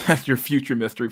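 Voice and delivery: usual speaking voice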